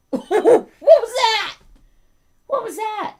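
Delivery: very high-pitched dog-talk voice; pet talk. Real, not acted. Owner teases dog about his odd noise, high pet talk. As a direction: squeal the question at your dog.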